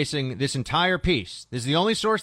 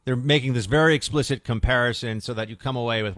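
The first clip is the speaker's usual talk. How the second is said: ordinary talk, nothing marked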